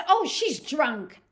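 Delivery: put-on slow, loud, dismissive "Oh, she's drunk"; lightly voiced bystander waving her away; dismissive man. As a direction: drawl it out like a man brushing her off. Man dismisses the fallen woman.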